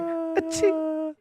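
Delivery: high squeaky little achoo; a dainty tiny sneeze. Pretend. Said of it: "a tiny little sneeze".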